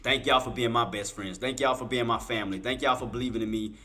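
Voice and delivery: low, soft heartfelt thanks; holding back emotion while thanking friends; sincere, hushed and touched; emotional gratitude. Real, not acted. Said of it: "I'm not trying to get emotional".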